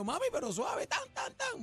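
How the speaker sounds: súplica aguda a mami; quéjate en tono muy alto, recreando el apretón; queja imitada. Retold or imitated. (queja aguda y exagerada)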